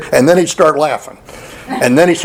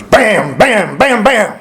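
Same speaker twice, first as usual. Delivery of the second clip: higher, sharp imitated gunfire bams; imitated gunshots; 7 semitones higher, much slower than usual. Hunter retelling sudden shots heard downhill, imitated gunfire. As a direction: punch out each bam like a shot.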